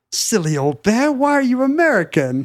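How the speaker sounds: put-on British accent, lifting high on the question; puzzled British storybook voice; British storybook character. Puzzling over Pooh's American voice, mock British accent. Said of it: "you'd think Pooh would be like".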